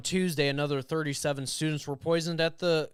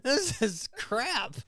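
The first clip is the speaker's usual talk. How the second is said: exasperated; 10 semitones higher, slower than usual. Real, not acted. Exasperated, slow.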